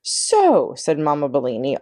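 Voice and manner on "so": 1 angry. A narrator's reading. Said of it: "all her despair changing into anger".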